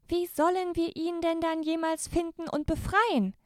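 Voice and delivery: put-on high, whiny girls' voice; grumbling girls. The girls grumble about finding Santa, high whiny voice. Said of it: "maulten die Mädchen".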